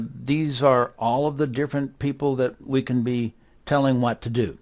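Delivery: usual speaking voice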